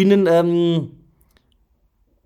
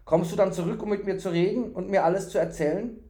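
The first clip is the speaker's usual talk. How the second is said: high, pleading question; lightly voiced fool longing for company; hopeful, eager fool; 7 semitones higher, 3 dB louder, faster than usual. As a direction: ask with innocent hope.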